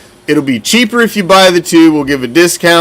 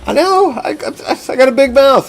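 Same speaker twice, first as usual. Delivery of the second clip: whiny high mock voice; 7 semitones higher, faster than usual. Mock self-defense in a voice.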